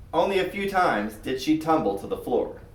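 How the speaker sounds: ordinary delivery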